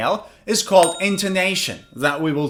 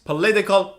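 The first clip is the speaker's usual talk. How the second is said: "Political" with LIT punched; "Political" peaking on the second syllable; stress on second syllable; 2 semitones higher, faster than usual. Shown as a demonstration. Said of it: "read the three of these words".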